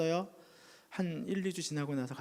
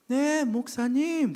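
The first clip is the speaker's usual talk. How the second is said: high, slow, louder reply of the sick husband; sick man answering; 10 semitones higher, 7 dB louder, much slower than usual. Retold or imitated. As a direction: answer like a man just waking. Dying husband answers weakly. (깨어난 환자의 대답)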